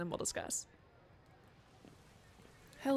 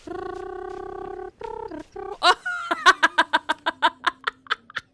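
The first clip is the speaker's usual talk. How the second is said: big high loud burst of laughter; 9 semitones higher, 9 dB louder than usual. Real, not acted. Player bursting out laughing at a basketball star. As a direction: crack up.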